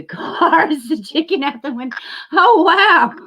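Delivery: high, laughing exclamation. Real, not acted. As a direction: laugh as you speak. Laughing at a photo.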